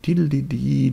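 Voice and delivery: high sung diddlidi like flutes; playful flute-tune mimicry; flute imitation. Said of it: "die hellen Flöten".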